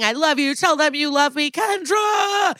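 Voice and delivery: high, shouted desperate plea; pleading shout. Retold or imitated. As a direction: beg at the top of the voice. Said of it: "He's pleading".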